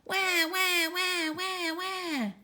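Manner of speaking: put-on high wailing baby cry. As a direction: wail like an unhappy baby. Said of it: "The baby looked at the teacher and said".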